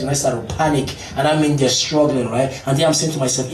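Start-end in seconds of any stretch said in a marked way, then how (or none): none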